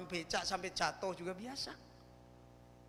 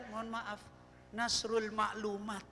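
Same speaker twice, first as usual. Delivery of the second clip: ordinary delivery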